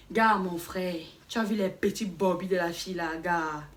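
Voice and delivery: low, soft conspiratorial street talk; hushed slang aside. Shown as a demonstration. Telling a buddy about a girl, low and hushed. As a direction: murmur it like a secret to a friend. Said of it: "on prend dans une phrase".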